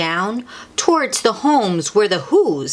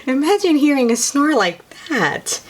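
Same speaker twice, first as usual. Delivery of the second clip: much higher, delighted voice; 8 semitones higher than usual. Real, not acted. Cooing to children about the Whos' cute snore, delighted. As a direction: gush to the little ones.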